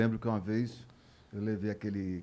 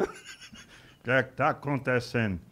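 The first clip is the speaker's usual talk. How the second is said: slow, dim-witted boxer voice; slow Maguila; slower than usual. Retold or imitated. Maguila on the show, slowly asking what is going on. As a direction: drag it out like a puzzled heavyweight. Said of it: "Aí diz".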